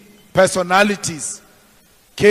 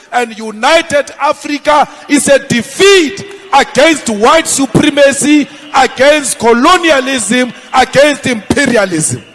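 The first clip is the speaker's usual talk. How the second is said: louder and higher, shouting to the crowd; 5 semitones higher, 6 dB louder than usual. Acted. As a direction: build to a rallying shout. Rally climax, shouting defiance.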